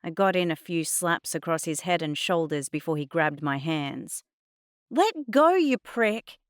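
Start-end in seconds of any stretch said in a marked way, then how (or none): 4.9-6.4 high, loud furious shout; angry shout. A narrator's reading. Girl to Adnan grabbing her hands, shouting. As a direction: shout it while struggling to break free. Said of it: "I shouted".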